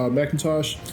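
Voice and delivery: ordinary delivery, nothing marked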